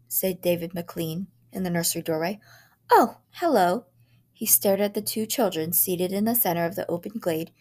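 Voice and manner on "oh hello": cheery visitor. A narrator's reading. Visitor greets children.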